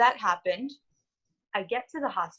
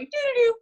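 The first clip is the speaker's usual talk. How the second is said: sung alarm chime; 13 semitones higher, 4 dB louder, faster than usual. Retold or imitated. Imitating morning alarm.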